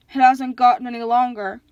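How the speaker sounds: loud, forceful monster complaint; complaining monster. A narrator's reading. Monster inspects the bride's weaving, loud complaint. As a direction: complain loudly, at your own pitch.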